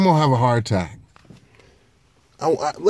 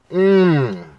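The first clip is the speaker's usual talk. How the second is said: savoring mmm; 6 semitones higher, 4 dB louder than usual. Real, not acted. First bite of pizza.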